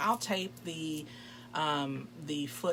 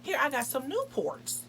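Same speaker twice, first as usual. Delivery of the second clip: higher, eager offer of cigarettes; eager date; 10 semitones higher than usual. Retold or imitated. Date eagerly offering cigarettes in a higher voice. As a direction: chirp it up, happy to share. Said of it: "He's like".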